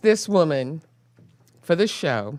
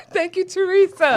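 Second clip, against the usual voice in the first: high-pitched, through laughter; giggly, high thank-you; laughing thanks; 10 semitones higher than usual. Real, not acted. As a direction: say it while still laughing. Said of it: "never seen her laugh this much".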